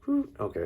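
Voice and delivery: very high relieved exhale, then okay; relieved whew. Retold or imitated. After a wave of feeling passes, high relieved whew. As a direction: blow out the tension, then settle. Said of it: "And then I'm like".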